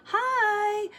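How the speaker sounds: put-on high eager greeting from a child; little girl's hi. Girl to kids on bikes, high eager hi. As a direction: pipe up hi to the big kids.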